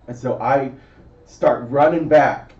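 usual speaking voice

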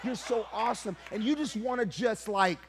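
loud, high, shouting sermon climax; preaching at full force. Acted. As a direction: cry it out to the church.

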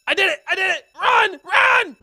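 high triumphant shouts. Real, not acted. Gamer escaping the robbery, high excited shout. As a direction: yell it as you bolt.

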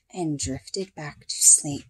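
soft and sleepy. A narrator's reading. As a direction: drift off gently. Bedtime ending line.

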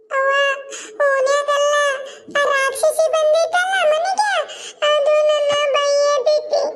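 put-on high, slow, whimpering child voice; tearful little child. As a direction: wail out the complaint like a hurt child. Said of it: "ಯಾಕ ಅಳಕತಿ?". (ಅಳುತ್ತಾ ದೂರು ಹೇಳುವ ಪುಟ್ಟ ಮಗುವಿನ ಎತ್ತರದ ದನಿ)

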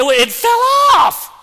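exasperated shout. Real, not acted. Telling the crowd about the lost tire, exasperated shout. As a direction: belt it out with a drawn-out OFF. Mock outrage at tire falling off.

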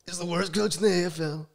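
mock Trump blustering about the worst coach; Trump impression. Podcast guest trashing the Bulldogs coach, as Trump. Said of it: "Donald Trump accent pre-show".

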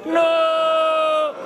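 crowd-leading shout. Real, not acted. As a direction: belt No! like a crowd chant. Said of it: "With me, everybody".

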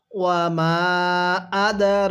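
ওয়া মা আদর stretched slowly, each syllable held; slow teacher recitation with the long vowels held; slow recitation demo. Shown as a demonstration. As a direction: model reading for students to repeat. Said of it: "আরেকবার পড়ুন".

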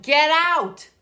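loud barked order; angry bear. A narrator's reading. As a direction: snap at them to leave.

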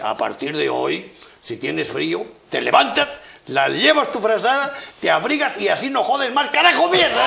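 gruff husband losing his temper, ending in a shout; changed voice, angry. Retold or imitated. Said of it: "cambia de voz y dice".

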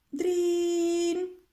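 high, loud imitated bell ring; alarm ring. A narrator's reading. Narrator imitating the hare clock's alarm, high ringing call.

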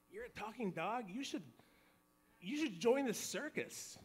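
high-pitched mock astonishment, lightly voiced; amazed waitress. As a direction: play a waitress marveling at a talking dog. Waitress amazed at talking dog.

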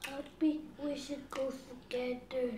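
soft pleading. Real, not acted. Child promising mother happiness, soft low plea. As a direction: offer it meekly and softly.

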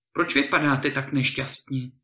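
ordinary delivery

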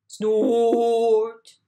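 loud snorting roar building; machine snort. A narrator's reading. The big digger snorts at the baby bird, loud.

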